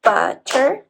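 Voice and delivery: "butter" in two separated beats; syllable by syllable. Shown as a demonstration. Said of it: "then second part".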